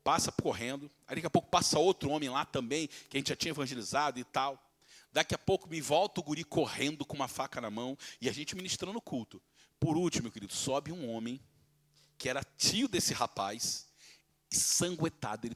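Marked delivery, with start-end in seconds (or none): none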